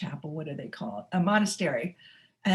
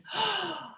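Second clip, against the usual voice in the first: sharp high gasp; gasp of realization; 11 semitones higher, 5 dB quieter than usual. Pretend. Listener waking up to who they are, sharp gasp. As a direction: catch your breath in sudden wonder.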